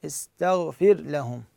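slow istaghfir lahum with separate r and l; ra and lam both pronounced. Shown as a demonstration. Teacher saying the model reading, ra and lam distinct. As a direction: keep r clear before l, unmerged. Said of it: "الراء تلفظ واللام تلفظ اثنيناتهن".

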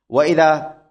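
ordinary talk, nothing marked

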